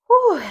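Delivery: high breathy whew of effort; winded whew. Real, not acted. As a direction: blow it out, spent. Winded mid-workout exhale.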